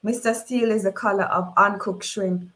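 ordinary delivery

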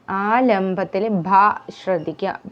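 usual speaking voice

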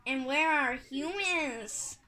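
ordinary delivery

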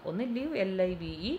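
usual speaking voice

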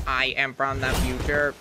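low, flat-toned robot line; half-hearted robot voice from the future; mock robot voice. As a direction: play a lightly voiced time traveller robot. Playing a time traveller.

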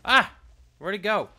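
high, loud startled shout; startled yell. Real, not acted. Player startled mid-fight, yelling. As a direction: yell then look round confused.

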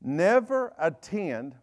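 very slow, drawn-out "Never attend". Shown as a demonstration. Preacher slows right down so the church catches the verse. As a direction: stretch each syllable out. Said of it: "I'm gonna go slow".

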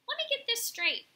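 high, loud, brash bird voice; curious pigeon. A narrator's reading. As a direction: pipe up like a nosy pigeon. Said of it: "said the curious pigeon".

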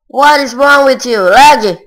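real frustration at the lag; furious yell. Real, not acted. Raging at the lag.

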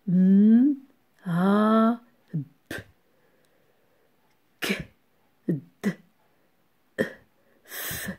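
M and A held, P, Q, D as short bursts; isolated letter sounds. Shown as a demonstration. Teacher lists known sounds for pupils, each letter sounded alone. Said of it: "donnez-moi des sons que vous connaissez".

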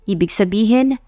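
ordinary delivery, nothing marked

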